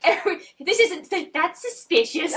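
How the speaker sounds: high, spluttering protest through laughter; laughing exasperation. Real, not acted. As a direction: protest helplessly while cracking up.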